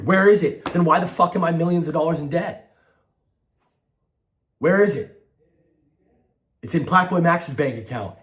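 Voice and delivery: angry. Real, not acted. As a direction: shout it out in frustration. Angry venting about FaZe members.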